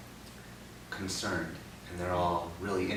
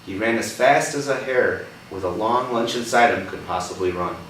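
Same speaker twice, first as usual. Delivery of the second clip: put-on reading a picture book to a child; gentle read-aloud for a young listener; story reading; 6 dB louder than usual. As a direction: tell it like a storybook.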